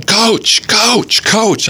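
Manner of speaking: high, pleading imitation of a crying kid; retold crying plea. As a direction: call out high and desperate, like a panicked boy. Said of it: "and he's crying".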